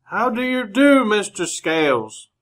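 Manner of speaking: put-on polite greeting half-shouted at a deaf old man; loud. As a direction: raise your voice so a deaf old man hears. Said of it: "Just talk good and loud".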